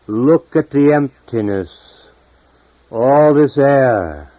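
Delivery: high, loud, drawn-out proclamation; declaiming. Acted. Painter urging us to see the empty air, high loud declaiming. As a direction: proclaim it loudly, stretching the last words. Painter's words voiced in poem.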